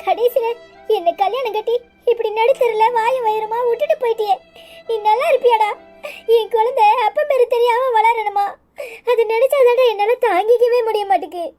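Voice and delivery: tearful, wailing lament; weeping in despair; crying. Acted. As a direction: sob through the bitter complaint. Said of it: "எதுக்கு இப்படி அழுதுட்டு இருக்க".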